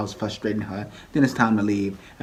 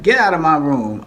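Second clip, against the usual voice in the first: louder, higher snapping order; angry order; 6 semitones higher, 7 dB louder than usual. Retold or imitated. Nene ordering her producer out, loud and higher. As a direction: throw him out of the room.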